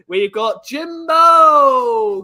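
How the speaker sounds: slow, loud, stretched-out welcome; drawn-out welcome. Real, not acted. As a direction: drag out the name like an announcer.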